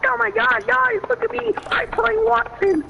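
high, loud excited gloating. Real, not acted. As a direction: show off, squealing high. Showing off in game.

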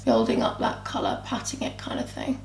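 whispered commentary while blending. Real, not acted. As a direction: let it fade into a whisper. Quiet aside while blending.

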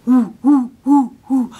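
loud hooting monkey "ooh ooh"; short punchy baboon hoots; baboon sneer. A narrator's reading. Baboon sneers at lion, hooting. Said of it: "Baboon sneered".

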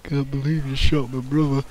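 usual speaking voice

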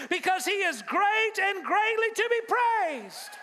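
preacher calling to suffering listeners, rising to a shout; full-force preaching. Acted. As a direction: build the call and response to a shout.

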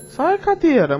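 high, loud yelp at the chair; sudden yell. Real, not acted. Gamer shoving a chair aside, loud yelp. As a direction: bark it at the furniture blocking you.

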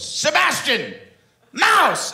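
very high ringing call to the hawk. Retold or imitated. Pirate lady calling her hawk to catch food: high ringing call. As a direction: sing out the hawk's name.

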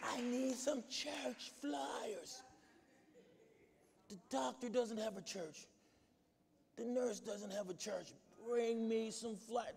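soft, slow, strained plea; a hint of a frail patient, slow and soft; sick woman's weak voice. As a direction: bedridden woman asking her pastor for flyers. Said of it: "She said".

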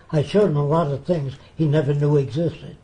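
normal talking voice

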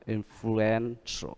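influential very slowly, softly, syllable by syllable; soft, drawn-out influ-EN-tial; slow stress demo. Shown as a demonstration. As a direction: break influential into parts, holding EN. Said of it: "hai và ba".

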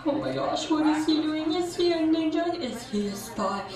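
soft, hushed and panicky under the breath. Acted. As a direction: mutter your panic so he can't hear.

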